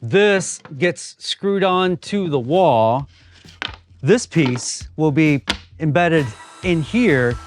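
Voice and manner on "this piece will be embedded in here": ordinary talk, nothing marked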